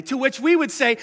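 ordinary talk, nothing marked

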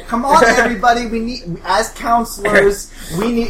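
rally the counselors with forced enthusiasm; perky camp girl. Acted.

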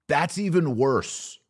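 usual speaking voice

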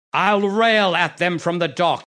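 loud, ringing defiance; defiant ranting. Acted. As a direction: rail at the crowd, fierce. Villain vows to shout on scaffold.

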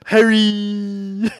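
a male narrator playing a schoolgirl; Jim Dale as Hermione. Podcast host imitating the audiobook Hermione, high falsetto. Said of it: "And he says".

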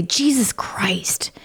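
slow whispered "Jesus Christ"; whispered oath. Retold or imitated. Friend quoting the swear people say, whispered slowly. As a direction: breathe it out under your breath, slowly. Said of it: "you know how we say".

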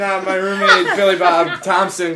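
normal talking voice

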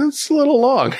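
squeaky, hedging high voice; high-pitched admission. Real, not acted. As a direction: pitch it way up, sheepishly. Admits song length over friend's reassurance.

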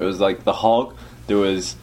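usual speaking voice

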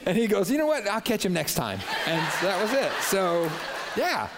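high and loud, cracking up; story punchline through real laughter; laughing through the punchline. Real, not acted.

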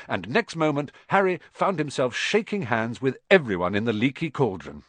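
ordinary delivery, nothing marked

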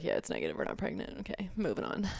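flat deflated murmur. Retold or imitated. Retelling quiet reaction to test.

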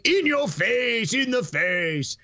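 drawn-out catchphrase holler; mock shout. Naming the police station scene, mock shouted catchphrase. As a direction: belt it out slowly like a movie quote.

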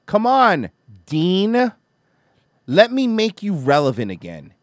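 high, loud taunting call; loud goading. Real, not acted. Host daring Dean to be relevant again, goading loudly. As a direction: call him out at full voice, then ease slightly.

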